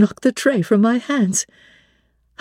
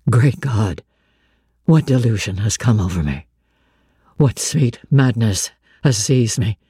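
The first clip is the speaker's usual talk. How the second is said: deep, stunned man fearing madness; low and shaken, disbelieving; low Rochester voice; 9 semitones lower than usual. A narrator's reading. Blind master hearing Jane's voice, low and stunned.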